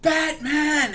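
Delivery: panicked yell for help, very high and loud; hammed-up high-pitched holler; Joker. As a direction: scream out for a rescuer. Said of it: "he just starts yelling for Batman".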